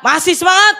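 a host pumping up a studio audience; hype host. Acted. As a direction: fire up the crowd. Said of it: "warming up studio audience".

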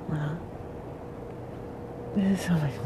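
soft whisper of wonder; awed whisper. Real, not acted. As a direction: breathe it out at the view. Awed at the view.

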